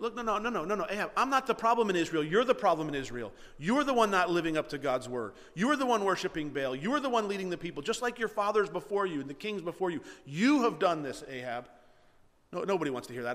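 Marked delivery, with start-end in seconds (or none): 0.0-11.7 loud, forceful rebuke that starts with a laugh; Elijah rebuking the king. Retold or imitated. Elijah tells Ahab off, loud and forceful. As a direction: set the king straight, hard and loud. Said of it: "Elijah puts him straight".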